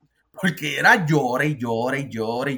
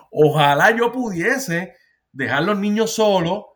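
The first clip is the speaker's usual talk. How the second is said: laughing; 4 semitones higher, 5 dB louder than usual. Real, not acted. Laughs at his own wish.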